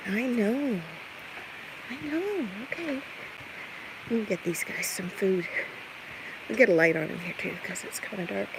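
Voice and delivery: ordinary talk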